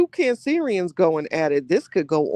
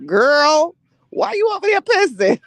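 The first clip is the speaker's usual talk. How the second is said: high, loud teasing call-out; mock scolding call; 12 semitones higher, 6 dB louder than usual. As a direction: shout it high at a friend. Calls out friend in story.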